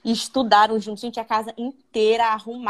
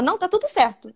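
a hint of a bride's denial, slightly raised; lightly voiced bride; 7 semitones higher than usual. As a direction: say it lightly, brushing it off. Primeira negação da noiva. (noiva negando, levemente mais aguda)

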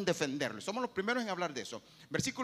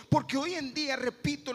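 ordinary talk, nothing marked

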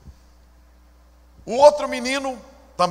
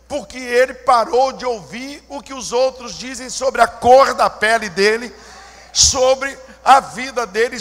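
rising, louder sermon proclamation; preaching at full force; 3 semitones higher, 8 dB louder than usual. Acted. Pastor exalting the doctor to the church, rising, louder preaching. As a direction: drive it home to the congregation.

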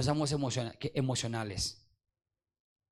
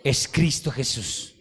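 louder rousing preacher cadence; preaching at full force; 6 semitones higher, 10 dB louder than usual. Acted. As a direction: stir up the congregation.

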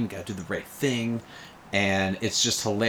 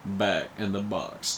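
mock Alabama drawl on "Put the bunny back in the box"; Alabama accent. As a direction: imitate Cage's Alabama accent, slowing at the end. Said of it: "doing this Alabama accent".

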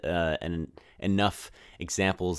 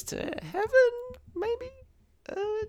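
high squeak; slower than usual. Pretend. Mock-mysterious upswing on heaven.

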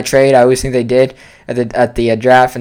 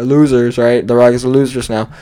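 ordinary talk

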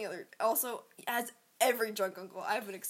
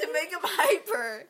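laughing; 6 semitones higher, 8 dB louder, slower than usual. Real, not acted. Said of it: "I can't understand you".

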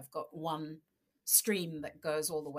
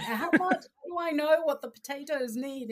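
higher, louder mock-frantic question; whiny disbelieving plea to mum; exasperated protest; 9 semitones higher, 8 dB louder than usual. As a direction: act baffled and put out by her vague answer. Retelling exasperated exchange with mum.

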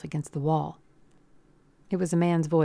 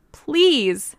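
high, loud desperate plea; begging; 9 semitones higher, 9 dB louder than usual. A narrator's reading. Girl shielding the man from the gun, begging. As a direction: cry it out, begging. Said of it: "she begged Burkhart".

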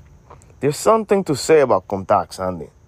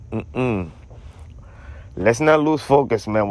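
ordinary talk

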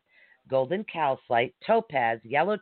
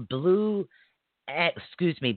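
usual speaking voice

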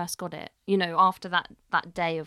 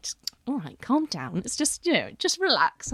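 calming dad; 6 semitones higher, 4 dB louder than usual. Retold or imitated.

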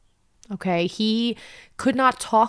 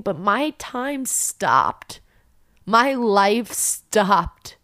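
very slow, weighted words; slow and heavy; much slower than usual. Real, not acted. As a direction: let each word sink. Numb realization, slowing down.

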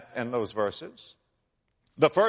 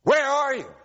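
retold shout at God; 10 semitones higher, 3 dB louder than usual. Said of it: "He's shouting at God".

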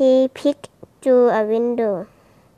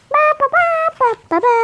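high chant; 3 dB louder, much faster than usual. Acted. Sound effect chant.

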